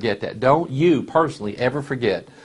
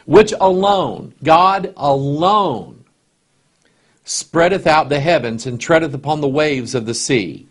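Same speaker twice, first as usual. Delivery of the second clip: solemn scripture reading; 3 semitones higher, 3 dB louder than usual. Acted. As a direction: stretch out each word of the verse. Preacher reading Job aloud.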